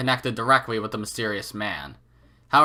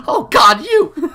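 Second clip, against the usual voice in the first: much higher, much louder shocked cry; shocked shout; 11 dB louder, much slower than usual. Real, not acted. Reader shocked by the line, crying out loud. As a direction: blurt it out in horror.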